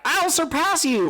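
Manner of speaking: put-on high, bright boyish shout-free bravado; imitating Luffy. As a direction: chirp it up high like the hero. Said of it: "The worst he said in those fights was like".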